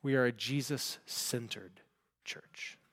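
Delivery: slow, near-whispered preaching; very soft, solemn statement; hushed emphasis. Real, not acted. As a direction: let it drop to a slow whisper for weight. Preacher hushes for the key point.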